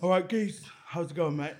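lightly voiced laddish bloke, low and soft; a hint of a blokey straight lad greeting a mate; straight boy impression. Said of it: "your best straight boy impression".

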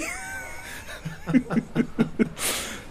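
long, high breathy laugh; helpless laugh after telling the story. Real, not acted. Laughs after retelling the snub.

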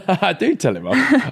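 laughing protest; denying it through laughter. Real, not acted. Laughing denial.

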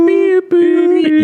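very high loud electronic beep; heart monitor beeps. Retold or imitated. Mimicking the heart monitor in the prank, piercing high beeps. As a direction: squeak it out like a hospital monitor.

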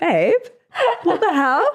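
high, laughing disgusted babe; laughing Hannah impression. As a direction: squeal it through a laugh. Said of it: "Hannah's reaction".

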